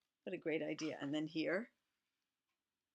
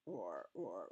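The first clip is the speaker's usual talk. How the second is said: low growled mock roar; lion roar; 11 semitones lower, 7 dB quieter than usual.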